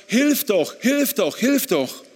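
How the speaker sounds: high, loud plea for help; desperate cry. Retold or imitated. Husband begging God to help his sick wife, desperate cry. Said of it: "Und ich rufe zu Gott".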